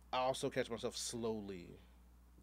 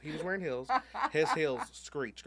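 laughing; 6 semitones higher, 8 dB louder, slower than usual. Real, not acted.

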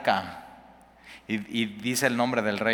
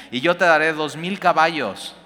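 louder, higher scornful offer; mocking taunt; 5 semitones higher, 6 dB louder than usual. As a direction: toss the offer out like a dare from a bully. Rabsaces taunts Ezequías's envoys. (burla arrogante del enemigo, más alta y fuerte)

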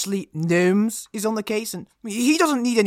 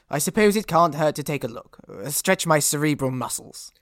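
ordinary talk

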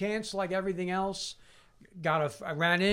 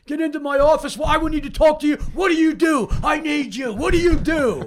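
loud, high-pitched furious yell; angry boss; 11 semitones higher, 9 dB louder, faster than usual. Retold or imitated. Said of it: "he just started screaming".

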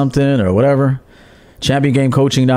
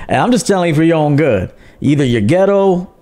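ordinary delivery

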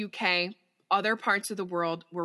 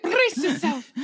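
put-on Scottish accent; 9 semitones higher, 7 dB louder than usual. Scottish voice continued.

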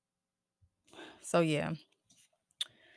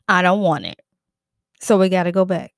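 louder firm refusal; 2 semitones lower, 8 dB louder than usual. Real, not acted. Rejecting bad purchase.

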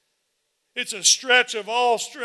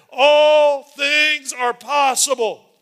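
slow preaching shout; 5 semitones higher, 10 dB louder, much slower than usual. Real, not acted. Pastor shouting the promise to the congregation, slow and loud. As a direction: stretch every word out in a big, slow shout.

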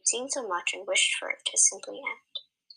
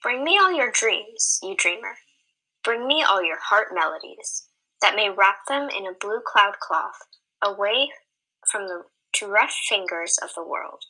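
poem recital; 5 dB louder than usual. Acted. Reader opening the story with a poem, recited. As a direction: read the poem like an offering.

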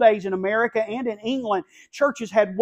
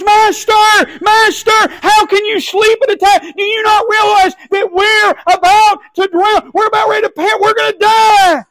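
high, loud, panicked yelling; frantic scream for help in a sinking boat; desperate shout; 12 semitones higher, 10 dB louder than usual. Acted. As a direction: cry out like men about to drown. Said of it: "It was".

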